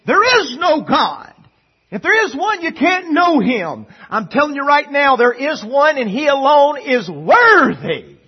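loud, high-pitched pulpit cry; full-force preaching. Acted.

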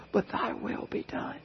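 soft whisper after the cry; whispered surrender. Acted. Jesus yields to the Father, whispered. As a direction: breathe the words in surrender. Jesus's prayer ends softly.

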